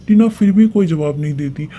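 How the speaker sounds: plain reading voice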